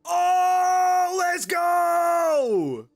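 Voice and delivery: loud high whoop of joy; excited shout. Real, not acted. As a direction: shout it out, thrilled. Excited shout at puzzle solved.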